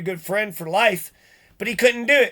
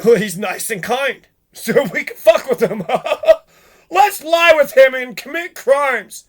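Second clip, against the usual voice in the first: mocking laugh; 3 semitones higher, 6 dB louder than usual. Mocking what the neighbours think.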